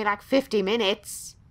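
ordinary talk

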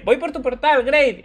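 high mock robot chirp; Pathfinder quote. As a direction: pitch it up like the cheerful robot. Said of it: "que le dice". (imitando a Pathfinder, voz aguda de robot alegre)

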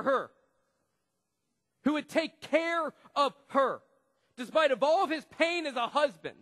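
loud, high, shouted sermon lines; full-force preaching. Acted. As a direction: passionate pulpit shout. Preaching on Hosea's loyalty.

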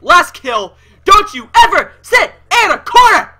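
triumphant rage, building to a high scream; loud yelling. Real, not acted. Gamer to the camper he just killed, loud triumphant yelling. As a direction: yell it full force at a beaten opponent. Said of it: "Sorry, that was loud".

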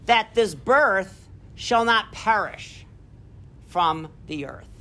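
slow, fading close of a speech; solemn closing line; slow solemn reading. Acted. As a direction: let it sink slowly to the end. Reads Gettysburg Address aloud.

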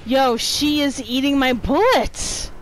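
ordinary talk, nothing marked

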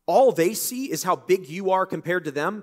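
high, loud, fervent declaration; fervent exclaim. Retold or imitated. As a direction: preach it boldly as David. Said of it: "David said".

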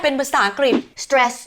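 normal talking voice

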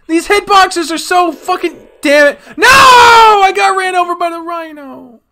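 high, loud wailing complaint; exasperated yelling. Real, not acted. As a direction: howl at the unfair game. Run over in game.

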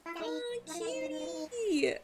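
very high little coo; high-pitched, melting delight; adoring squeal. Real, not acted. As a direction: coo at something tiny and adorable. Gushing over cute villager.

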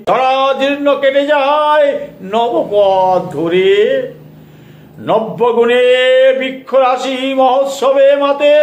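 declaimed verse with long drawn-out line endings; poem recitation. Acted. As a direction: recite the poem in ringing, measured phrases with pauses. Recites own New Year poem.

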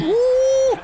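high whoop of joy; excited whoop. Real, not acted. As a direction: celebrate the shot. Scoring a three in game.

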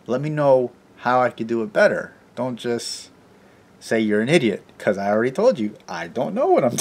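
normal talking voice

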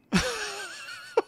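high-pitched burst of laughing; helpless laughter. Real, not acted. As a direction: crack up uncontrollably, way up high. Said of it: "I don't know why I'm laughing".

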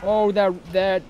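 scared high yelps; panicked at the returning monster; real fright. Real, not acted. Monster returns in horror game.

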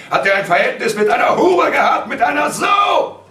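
high, loud tirade building to a scream; scornful shouting accusation; father's furious rant. A narrator's reading. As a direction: rage on, climax on the last insult. Father rants accusations to mother.

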